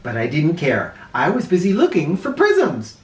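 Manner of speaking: ordinary delivery, nothing marked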